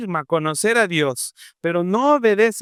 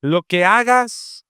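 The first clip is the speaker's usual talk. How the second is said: ordinary talk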